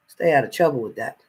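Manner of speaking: ordinary talk, nothing marked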